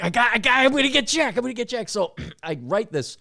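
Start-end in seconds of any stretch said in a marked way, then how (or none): 0.0-1.9 louder, much higher giddy scheming; a kid plotting mischief; gleeful prank plan. Retold or imitated. Friend telling his girlfriend about the prank, giddy and loud. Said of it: "I'm like".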